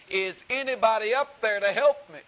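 high, loud cry for help at full volume; all-out shout. Acted. Man on the root shouts his last plea, loud and desperate. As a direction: give it everything you have. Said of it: "with all of the volume that he could muster".

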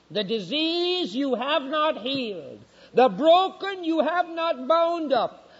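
slow, high, ringing pulpit reading; drawn-out prophetic indictment; scripture condemnation. Real, not acted. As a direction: pronounce each charge slowly, like a prophet.

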